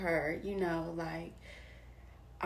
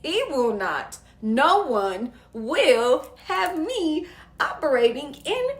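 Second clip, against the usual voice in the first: loud, high declaration, drawn out with punchy emphasis; defiant outburst; 8 semitones higher, 13 dB louder, slower than usual. Retold or imitated. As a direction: belt out a fired-up refusal, laughter creeping in. Defiant reaction to realizing her fear.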